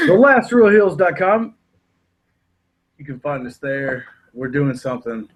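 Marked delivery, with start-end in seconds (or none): 0.1-1.5 high, loud wrestling promo call; hype announcer. Acted. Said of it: "put us over really hard".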